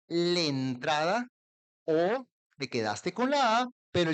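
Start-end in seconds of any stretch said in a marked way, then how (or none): 0.1-1.3 "le entrada" with the a dropped, said slowly. Shown as a demonstration. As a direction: say "le entrada", merging la into le.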